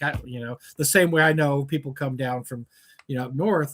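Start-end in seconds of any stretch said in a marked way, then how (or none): none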